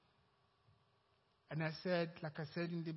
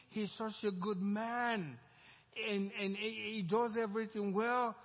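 admiring praise; 5 semitones higher, 5 dB louder than usual. Retold or imitated. Unbeliever praising his partner.

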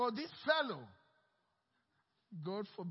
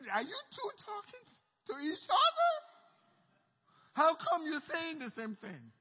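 high, louder mock disbelief; mock outrage; 7 semitones higher, 6 dB louder than usual. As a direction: squeal it as a hammed-up offended hearer. Angry listeners mocked.

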